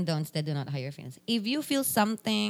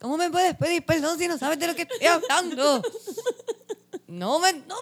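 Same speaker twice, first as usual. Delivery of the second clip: high, wailing mock sob; mock crying; 9 semitones higher, 3 dB louder than usual. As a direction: ham up a tearful breakdown. Mock tearful rant.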